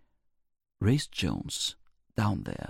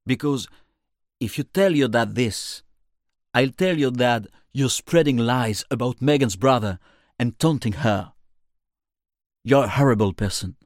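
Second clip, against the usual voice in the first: loud, raised accusing voice; angry telling-off; 3 semitones higher, 7 dB louder than usual. Acted. Narrator confronting Reese about lies, loud angry scolding. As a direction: scold forcefully, voice up and projected.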